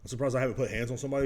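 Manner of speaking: normal talking voice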